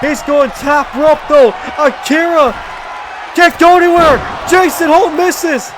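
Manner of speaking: excited play-by-play, rising pitch; calling the match live, pumped up; wrestling commentator. Acted.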